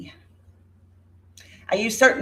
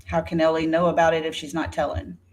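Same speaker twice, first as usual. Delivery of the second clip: ordinary talk, nothing marked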